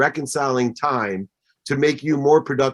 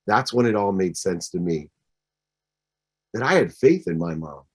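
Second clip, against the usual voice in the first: ordinary delivery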